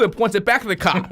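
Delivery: ordinary delivery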